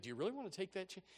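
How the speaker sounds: usual speaking voice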